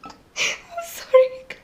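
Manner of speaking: high, slow words squeezed through laughter; helpless laughter. Real, not acted. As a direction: apologise while cracking up for real. Apologises while overcome with laughter.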